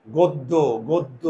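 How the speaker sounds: drawn-out "গদ্য, গদ্য", barely opening the mouth; slow pronunciation demo. Shown as a demonstration. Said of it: "আমার মুখের চোয়াল খুলে না".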